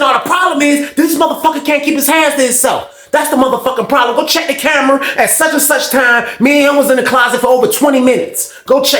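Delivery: high, insistent accusing rant; imitating an aggrieved high-pitched tirade; heated complaint. Acted complaint to the counselor.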